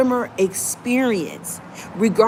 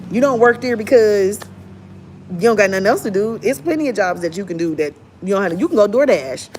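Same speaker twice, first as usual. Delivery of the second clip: ordinary delivery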